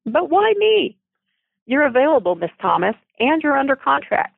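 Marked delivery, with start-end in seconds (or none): 0.1-1.0 incredulous protest. Acted. Heroine protests being chosen.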